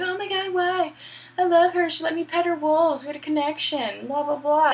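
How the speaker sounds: high-pitched, whiny mock heartbreak; hammed-up high-voiced lover in despair; mock lovesick lament. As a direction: wail about lost love in a mocking high voice. Acting out Otacon distraught over lost love.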